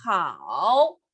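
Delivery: Hao dipping low, then rising; third tone. Shown as a demonstration. Teacher showing third tone, Hao low and dipping. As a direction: sink low on "Hao" and curl back up. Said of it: "Con el tercer tono".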